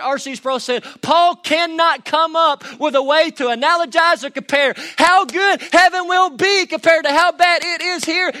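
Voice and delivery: building from quick talk to a high, loud shout; rising sermon crescendo, then full shout; preaching at full force. Acted. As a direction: preach it like the roof's coming off.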